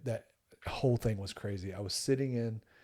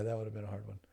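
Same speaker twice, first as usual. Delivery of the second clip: ordinary talk, nothing marked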